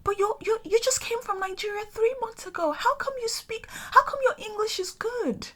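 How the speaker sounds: high, patronizing mock surprise; condescending Londoner. Imitating Londoners praising her English, high and patronizing. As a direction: mimic their fake amazement. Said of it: "Sometimes they're like".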